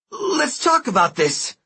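high, slow nervous pleading; scared pleading. Acted. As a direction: beg to calm her down. Thug scuttles away scared.

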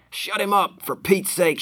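high urgent yell; panicked shout. Acted. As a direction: shout in a panic to silence him. Panicked thug during a struggle.

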